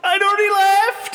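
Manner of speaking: high, very loud wail; hammed-up cowardly cry; mock distant shout. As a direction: call back while fleeing. Calls back having already fled.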